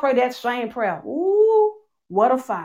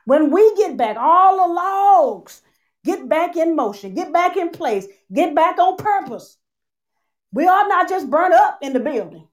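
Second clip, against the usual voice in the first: louder preaching restatement; 5 semitones higher, 5 dB louder than usual. Shown as a demonstration. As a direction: build it up again with fervor. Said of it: "I'm gonna say it to you again".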